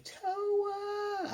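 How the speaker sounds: long, very high mock scream of "Towa", held back in volume; half-hearted wail, stretched out on a high pitch; imitated scream. Recapper imitating Rin as Towa falls, restrained mock scream. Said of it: "the Rin scream of".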